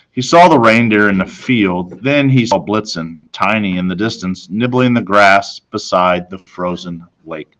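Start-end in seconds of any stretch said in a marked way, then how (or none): none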